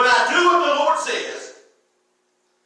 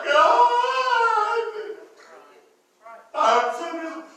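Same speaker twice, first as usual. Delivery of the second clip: hammed-up whining prayer; mock crying; 8 semitones higher than usual. Shown as a demonstration. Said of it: "it is not time to go cry".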